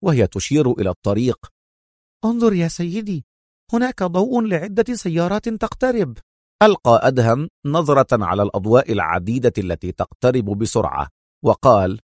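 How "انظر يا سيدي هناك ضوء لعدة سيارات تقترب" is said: Mona calling out. A narrator's reading. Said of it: "صاحت منى مقاطعة".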